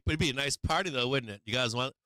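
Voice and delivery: ordinary talk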